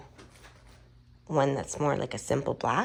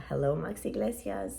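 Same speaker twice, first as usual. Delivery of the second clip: ordinary talk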